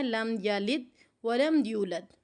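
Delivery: لم یلد with a stop on لم before ولم یولد; wrong way. Shown as a demonstration. Teacher demonstrates how not to recite this verse. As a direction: show the mistake, pausing on لم. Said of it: "ایسے نہیں پڑھنا".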